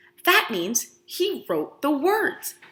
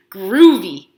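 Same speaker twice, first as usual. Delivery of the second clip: cool catchphrase; 3 semitones lower, 11 dB louder than usual. Acted.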